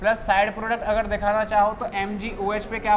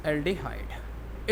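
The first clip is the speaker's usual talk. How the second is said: softer, lower, stretched-out syllables; slow, drawn-out; 5 semitones lower, 7 dB quieter, much slower than usual. Real, not acted. Teacher repeating a term while writing, slow and soft. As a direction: stretch the term out slowly and softly.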